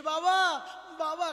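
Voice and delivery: loud, high call of "বাবা, বাবা", the second shorter; calling father. Retold or imitated. Shiva calls to Hari at his door, loud and high. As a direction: call out to him from a distance, pleading.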